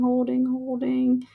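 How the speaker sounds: normal talking voice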